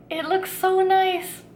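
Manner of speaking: slow, high admiring gush; delighted. Real, not acted. Admiring the pretty cobbler.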